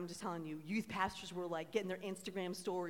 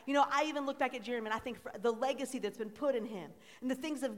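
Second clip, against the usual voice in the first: loud, rising call-and-response sermon; full-force preaching; 5 semitones higher, 8 dB louder than usual. Acted. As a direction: preach it out, asking the crowd to answer.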